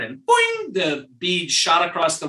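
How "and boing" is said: boing sound. Retold or imitated. Sound effect of bead.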